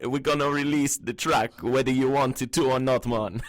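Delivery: badly faked Jamaican accent, played for laughs; bad Caribbean accent. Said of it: "I could be like".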